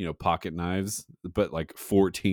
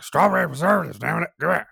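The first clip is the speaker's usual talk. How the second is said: much higher, loud cranky shout; angry old-man impression; 10 semitones higher, 7 dB louder, faster than usual. Old customer furious at confused teen worker, imitated angry shout. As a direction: demand it as if slamming a fist. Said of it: "slam his fist and be like".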